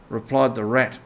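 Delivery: plain reading voice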